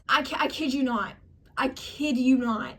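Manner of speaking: ordinary talk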